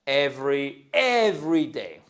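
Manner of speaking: every day said clearly, stress on every; repeat after me. Shown as a demonstration. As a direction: model every day for learners. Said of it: "Vous dites". (every day articulé pour la classe)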